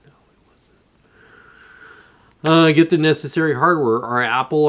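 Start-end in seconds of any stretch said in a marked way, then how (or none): 0.1-0.8 a quick whispered aside. Real, not acted. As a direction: whisper the denial to yourself. Answering his own joke.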